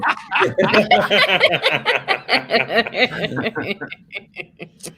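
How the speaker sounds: long helpless laugh. Real, not acted. Laughing at teasing.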